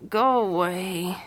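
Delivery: slow, drawn-out drowsy protest; sleepy grumble. Acted. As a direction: groan it out slowly, half asleep. Sleepy friend being woken up.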